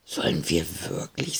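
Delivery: trembling voice. A narrator's reading. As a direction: ask it barely daring, voice shaking. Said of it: "mit zittriger Stimme".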